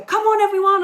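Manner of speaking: high, loud shout to rouse a crowd. Shown as a demonstration. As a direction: belt it out at the audience like a singer on stage. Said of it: "When I say shout, I mean go".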